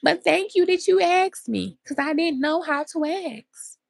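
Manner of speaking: high, relieved thank you; grateful reply. Retold or imitated. Answering a friend who offered help, high and grateful. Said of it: "And my friend asked me".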